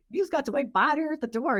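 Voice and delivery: mock exasperated wife. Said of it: "I'm like".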